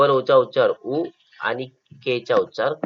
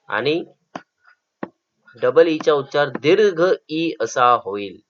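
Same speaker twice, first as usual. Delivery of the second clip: normal talking voice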